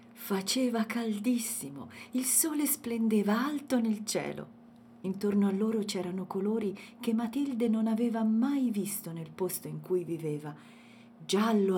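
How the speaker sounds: usual speaking voice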